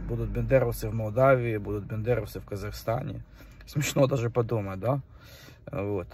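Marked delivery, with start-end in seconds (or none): none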